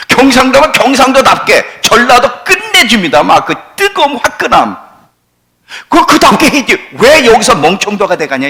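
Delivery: loud, high-pitched exhortation; rally them at full voice, exasperated; impassioned preaching. Real, not acted.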